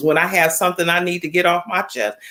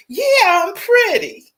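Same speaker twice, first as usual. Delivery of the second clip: mock proud self-boost; 13 semitones higher, slower than usual. Friend's self-pep after husband's words.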